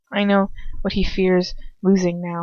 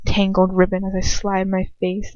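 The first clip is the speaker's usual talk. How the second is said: ordinary delivery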